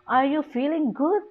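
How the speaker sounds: high question climbing to "good"; stress on GOOD. Shown as a demonstration. Teacher repeats the question example, stress on "good". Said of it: "The stress is on the good".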